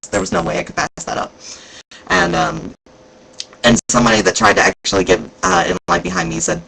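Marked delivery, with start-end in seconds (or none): none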